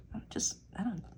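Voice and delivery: whisper. Real, not acted. Trails off self-conscious.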